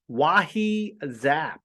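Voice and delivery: Wahi Zap said slowly, each syllable savored; savoring the name. Shown as a demonstration. Host enjoying the team name, slow and savoring. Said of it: "I like saying that".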